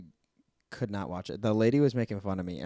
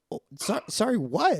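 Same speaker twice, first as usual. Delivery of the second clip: high, rising startled sorry what; a retold, half-mock startled question to the nurse; 10 semitones higher, 3 dB louder than usual. Telling a friend how she reacted to the nurse, startled high.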